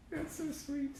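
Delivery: high, soft doting coo; cooing again. Real, not acted. Fan gushing at a clipping, high soft coo.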